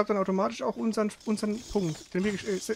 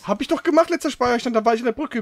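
loud and higher, fed up; real irritation, raised voice; exasperated protest; 5 semitones higher, 7 dB louder than usual. Real, not acted. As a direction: push back hard, irritated. Annoyed at having to backtrack.

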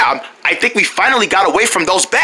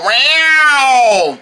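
long drawn-out cat yowl; eerie cat meow; 2 semitones higher than usual. Acted. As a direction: yowl like an unseen cat in a haunted house. Unseen cat answers.

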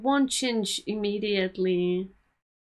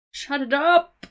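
loud, higher snapping yell; angry shout; 6 semitones higher, 7 dB louder than usual. Acted. Yelling at the mother on screen, angry shout. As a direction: shut her up with a yell.

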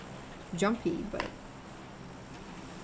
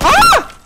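sudden very high, loud scream; startled yell; 18 dB louder than usual. Real, not acted. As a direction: yelp at full volume in the game. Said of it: "I'm sorry for yelling".

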